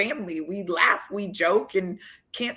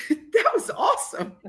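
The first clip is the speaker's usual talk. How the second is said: much higher, said through a laugh; 13 semitones higher than usual. Retold or imitated. The opposing player cracking up at her joke, laughing. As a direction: crack up while saying it.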